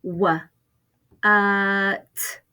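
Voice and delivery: "Wha-, uh, t" broken into separate sounds; segmented sounds. Shown as a demonstration. Teacher splitting what into its sounds for first graders. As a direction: pull "what" apart sound by sound. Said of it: "How many sounds do you hear".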